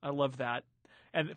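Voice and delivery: ordinary talk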